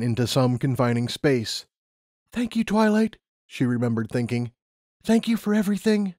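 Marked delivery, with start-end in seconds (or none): 2.3-3.2 lightly voiced higher-pitched young heroine; raised, even pitch for a thought; Lumina. A narrator's reading. Lumina silently thanking Twilight, higher-pitched character voice. 5.0-6.1 higher-pitched character voice, grateful; Lumina. A narrator's reading. Lumina thanking Twilight for everything, higher-pitched character voice. Figure's remembered thought.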